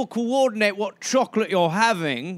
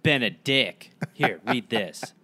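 lower, mock conspiratorial aside; mock sly schemer; 11 semitones lower than usual. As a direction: play the smug rumour-monger handing it over. Voicing Brown handing out pamphlets.

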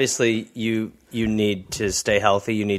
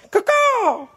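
high-pitched crow caw; bird caw. Retold or imitated. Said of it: "as we like to say".

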